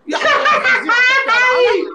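high, loud, drawn-out call with a laugh; calling from far away. Real, not acted. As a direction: shout his name across the room, laughing. Calling out, repeated louder.

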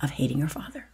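soft whisper of an inner voice. Retold or imitated. An inner voice telling her why, in a hushed whisper. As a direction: let it come as a quiet voice inside. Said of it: "And I hear a voice say".

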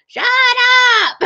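high, loud mock shout; big, drawn-out imitation holler; imitated yell. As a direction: yell it like a fed-up dog owner. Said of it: "so she could yell".

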